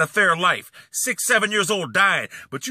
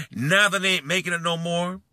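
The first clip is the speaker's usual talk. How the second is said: usual speaking voice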